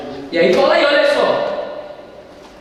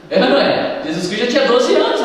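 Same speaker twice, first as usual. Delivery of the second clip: usual speaking voice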